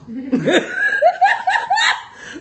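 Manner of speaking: shrieking burst of laughter, high and loud. Real, not acted. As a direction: cracks up loudly, voice jumping high.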